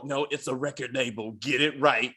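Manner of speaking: low Batman-style voice; Batman-like [name]. Acted. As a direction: answer back in a deep, mock-superhero voice. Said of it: "that motherfucker sound like Batman".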